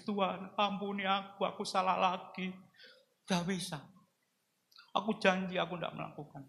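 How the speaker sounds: high, soft begging for forgiveness; tearful repentance. Retold or imitated. Preacher reliving his tearful confession to God, high and soft. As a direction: plead quietly as if weeping. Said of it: "saya nangis".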